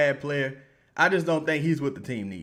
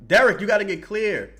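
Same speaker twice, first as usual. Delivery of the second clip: louder, higher call to a player; yelling at the TV; 8 semitones higher, 9 dB louder than usual. Real, not acted. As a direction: shout the name out like he can hear you.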